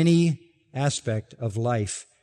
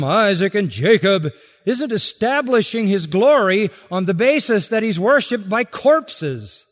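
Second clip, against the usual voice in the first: loud, higher pulpit climax; preaching at full force; 6 semitones higher, 6 dB louder than usual. Acted. Pastor proclaiming the living God to the congregation, full-force preaching. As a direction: build it up for the whole church.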